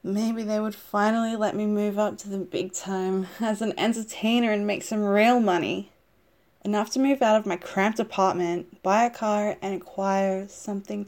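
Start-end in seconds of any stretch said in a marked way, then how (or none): none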